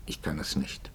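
fast tonlos geflüstert, gebrochen. Acted. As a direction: leise hauchen, kaum hörbar. Leises Eingeständnis des Pfarrers. (tonloses Flüstern)

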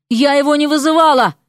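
heated outburst of denial; indignant denial. A narrator's reading. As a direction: fire back at the accusation. Denies accusation in quarrel.

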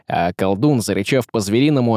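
usual speaking voice

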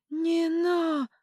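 high, loud terrified shout; horrified cry. A narrator's reading. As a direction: cry out in panic. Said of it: "с ужасом попытались сказать". (крик ужаса)

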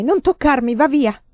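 panting order to be left alone, acted; ansimante, respinge. A narrator's reading. (respingendolo con affanno, a voce alta)

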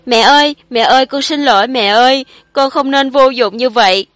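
a son breaking down, calling his dead mother; wailing to his mother. A narrator's reading. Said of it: "cuối cùng cũng gào khóc mà nói".

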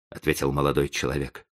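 ordinary delivery

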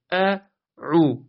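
«عو» با عینِ متوسط و کشیده. Shown as a demonstration. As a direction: say عو with a drawn-out, medium ayn. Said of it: "دومی توسط". (عو را کشیده و با حالت توسط بگو)

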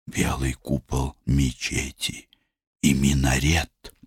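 soft, hushed coaxing voice; calm an angry man gently; soothing. Acted. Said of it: "чтобы смягчить его".

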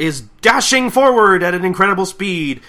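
loud, high play-by-play; race announcer. Acted. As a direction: call the race at full excitement. Acting the announcer's race call.

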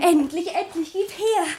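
loud, eager grab for the letter; eager excitement. Acted. Sabine snatching her long-awaited letter from the maid, eager. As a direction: burst out, impatient and thrilled.

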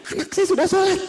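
high, bright burst of good news; excited mother. Retold or imitated. Mother telling the ustaz her child now prays, high and excited. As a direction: blurt it out with joy.

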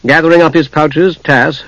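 usual speaking voice

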